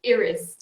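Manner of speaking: "Iris" with an ee sound at the start, EE-ris; "Iris" pronounced as in Austria, opening on ee; Austrian pronunciation. Shown as a demonstration. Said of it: "there it is pronounced".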